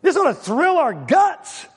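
high, thrilled preacher's exhortation; rousing exclamation. Real, not acted. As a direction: fire up the congregation, pitch soaring. Rouses congregation after mocking their boredom.

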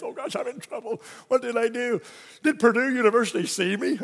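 high, worried inner voice; mock panic. Panicked thought of being in trouble.

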